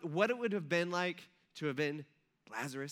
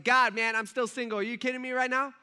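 louder, higher exasperated rant at God; mock frustrated outburst, loud and raised; exasperated complaint; 4 semitones higher, 5 dB louder than usual. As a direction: vent like a frustrated single guy. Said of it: "he's just like".

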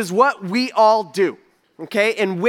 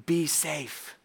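soft mock caution; 5 semitones lower, 11 dB quieter than usual. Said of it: "notice what he doesn't say".

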